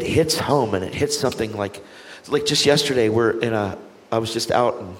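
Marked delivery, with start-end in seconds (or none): none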